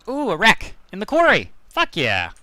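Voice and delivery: sudden high thrilled yelps; excited. Real, not acted. Streamer spotting a wreck in the quarry, excited. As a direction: blurt it out, delighted by the find.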